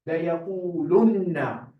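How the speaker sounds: لَيَقُولُنَّ recited fast with doubled noon held; emphatic recitation. Shown as a demonstration. Teacher reciting the word to show its emphasis. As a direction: stress the shadda on the noon in لَيَقُولُنَّ. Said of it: "See that lam after the kaf there".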